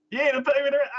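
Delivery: high, loud landlord imitation; mock rent demand. Recalling the landlord's demand, high and loud. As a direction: belt the landlord line for fun. Quotes the landlord line again.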